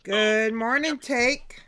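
bright, loud morning hello; cheery loud greeting. Real, not acted. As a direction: greet the listeners with big energy. Loud radio show greeting.

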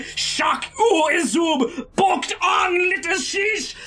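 high shouted wizard incantation; crying out spell. Acted. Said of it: "as his voice cries out".

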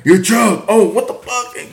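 jolted awake, voice leaping up in pitch; mock startled panic. Acting out being woken by the car alarm, mock startled.

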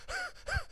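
quick huffing breaths, acted; effortful panting. Preacher miming a bird struggling to stay up, huffing. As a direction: pant as if flapping frantically. Mimes bird flapping hard.

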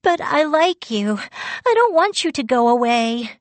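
high, mildly tearful protest. A narrator's reading. Crying girl begging Yu not to leave, mildly tearful. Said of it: "I burst into tears".

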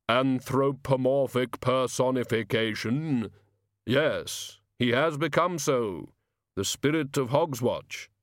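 slow, sonorous personification of death; stately, drawn-out pronouncement; Death, solemn. A narrator's reading. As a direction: intone each word slowly and gravely.

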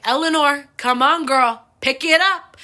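loud, high call to hurry up; mom calling out. Retold or imitated. Mom hurrying her daughter up the ladder, loud high call.

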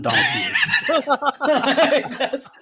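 bursting into laughter; words breaking into a laugh. Real, not acted. Cracking up mid-sentence.

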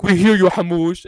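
loud teasing call-out. Retold or imitated. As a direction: call up the stairs to tease her. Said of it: "they're like".

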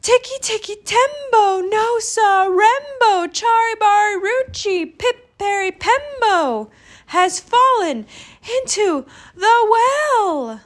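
put-on very high, loud, straining child; panted cry. A narrator's reading. Chang repeats brother's name to mother, panting. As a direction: push the long name out, high and breathless. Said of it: "he panted".

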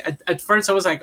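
normal talking voice

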